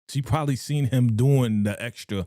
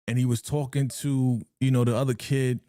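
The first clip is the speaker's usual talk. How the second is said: usual speaking voice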